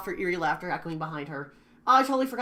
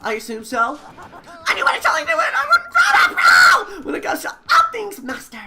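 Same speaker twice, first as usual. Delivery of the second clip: shrill, very high, loud manic killer; cackling unhinged serial killer; high loud crazed villain; Genocide Jill voice; 12 semitones higher, 11 dB louder than usual. Acted. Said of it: "voice her voice".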